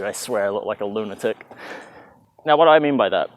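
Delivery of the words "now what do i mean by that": ordinary delivery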